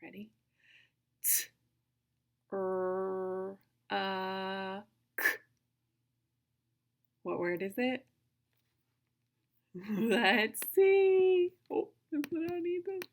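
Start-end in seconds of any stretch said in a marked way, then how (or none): 1.2-5.2 each sound separate, long pauses between; super slow sounds. Shown as a demonstration. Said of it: "do it one more time". 10.0-13.0 raised, drawn-out teasing reveal; playful suspense. Pretend. Suspense before revealing the hidden picture.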